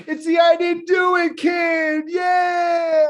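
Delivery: high, slow excited shout from a fan. Retold or imitated. Fans outside the door greet Bart, excited shout. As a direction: scream it out like a thrilled fan.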